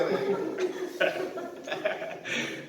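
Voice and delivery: a quiet, high airy laugh; breathy laugh. Real, not acted. El predicador riéndose del chiste, risa suave. Preacher laughs at own story.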